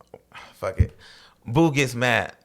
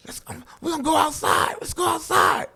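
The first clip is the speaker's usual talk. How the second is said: challenge to fight; 11 semitones higher than usual. Retold or imitated. Boo challenges someone to fight.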